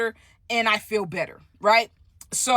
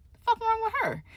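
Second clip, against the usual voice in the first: high, incredulous mock voice of bystanders; baffled onlookers; 13 semitones higher than usual. Bystanders in the hallway staring at the stud, incredulous. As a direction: ask it like a stunned stranger staring. Everybody else looking down like.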